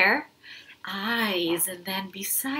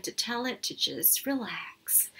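softer whispered calm-down; teacher whispers to calm the inner monster; soothing whisper; 3 semitones lower, 7 dB quieter than usual. Shown as a demonstration. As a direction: whisper it to settle an angry monster. Showing how to calm the monster.

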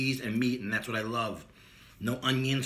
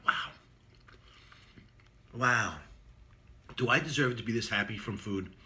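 talking between big bites; muffled chewing speech; mouth full of sandwich. Real, not acted. Seen 2: Sandwich pressed to mouth, biting and chewing throughout the labelled words.

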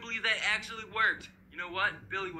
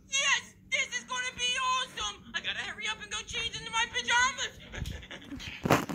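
put-on very high, excited child voice; overjoyed kid brother. Kid brother rushing to change into pajamas, overjoyed. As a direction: burst out in a gleeful kid voice.

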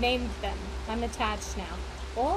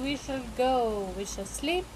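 ordinary delivery

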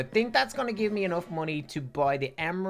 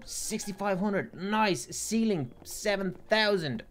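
normal talking voice

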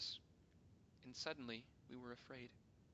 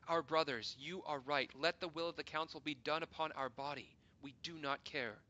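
urgent plea; 3 semitones higher, 9 dB louder than usual. Acted. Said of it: "we pleaded".

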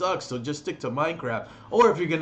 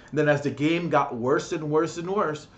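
ordinary delivery

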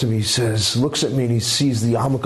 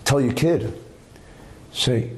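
usual speaking voice

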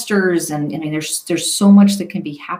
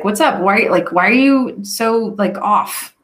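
louder, higher challenging question; pointed, less gentle demand; blunt, ungentle; 4 semitones higher, 3 dB louder, slower than usual. Shown as a demonstration. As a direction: confront them instead of checking in gently. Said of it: "can make a big difference versus like".

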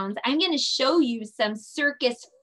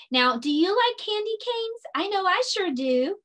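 cheery teacher question; 9 semitones higher than usual. Acted.

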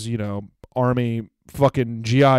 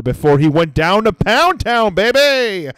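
mock hype; 5 semitones higher, 4 dB louder, slower than usual. Teasing about the layover trip, building hype. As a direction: build to a high, drawn-out holler. Crowing punchline about the man.

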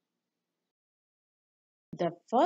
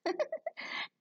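a high, breathy little giggle; a short high laugh; light giggle; 9 dB quieter than usual. A narrator's reading. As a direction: giggle at the silliness. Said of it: "Belle giggled".

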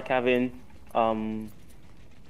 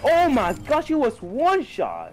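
excited; 10 semitones higher, much slower than usual. Real, not acted. Clutch moment in game.

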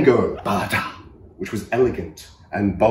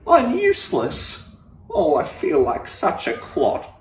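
Gerald's sad thought; 5 semitones higher than usual. Acted. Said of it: "he thought".

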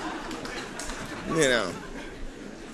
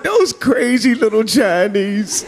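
mock thought; 4 semitones higher, slower than usual. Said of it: "I always think to myself".

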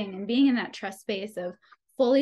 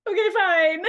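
laughing surrender; 8 semitones higher, 7 dB louder than usual. Retold or imitated. Giving in to the spirits.

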